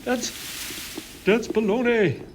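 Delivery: high, put-on Italian accent; hammed-up Italian objection; Francesco Redi. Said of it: "Francesco Redi was like".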